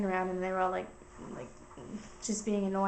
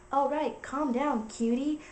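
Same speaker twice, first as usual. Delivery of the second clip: higher, drawn-out mock soothing; flirty texter; 5 semitones higher, slower than usual. Guy answering her threat, mock-flirtatious. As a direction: mimic a smug flirt, lilting up on the last word. Creepy guy's flirty reply acted out.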